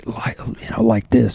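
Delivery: demonstrating a nearly lost voice; croaky voice. Shown as a demonstration. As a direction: drop into a voiceless laryngitis croak. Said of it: "when I thought I was gonna be".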